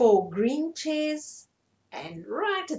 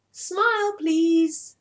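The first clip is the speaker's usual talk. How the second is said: high, loud cheerful smile please; photographer's cheer; 6 semitones higher, 6 dB louder, much slower than usual. Acted. Track asking the animals to smile, high and loud. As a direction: coax a grin for the camera. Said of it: "said Track".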